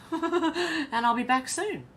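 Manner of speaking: real laugh into a high, drawn-out promise; laugh, then stretch it out high. Real, not acted. Signing off to stream viewers, laughing.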